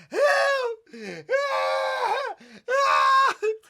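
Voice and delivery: very high, loud mock shrieks; hollering for help. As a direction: yell for help as if trapped. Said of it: "He's still hollering".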